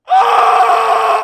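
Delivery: sudden piercing, high imitated scream; violent scream. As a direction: let out her shriek at full volume. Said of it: "like, violently".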